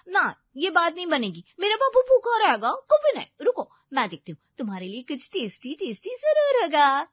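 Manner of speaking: put-on high, insistent girlfriend fuss; loud, high doting babble. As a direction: refuse firmly, pampering your baby in a high voice. Voices Payal insisting.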